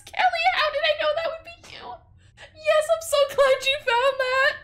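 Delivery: high, fast sing-song sarcasm; a hammed-up sweet greeting; mock cheery voice. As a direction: fake delight at Kelly. Mocking the game's character Kelly.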